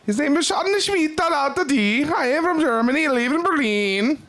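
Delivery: put-on high, slow German accent voice. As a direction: play a stiff German tourist. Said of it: "imitating German friend".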